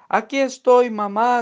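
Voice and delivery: sehr hoher, lauter Ruf nach oben; Schrei. Acted. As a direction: ruf dem Flugzeug verzweifelt nach. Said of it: "gritó".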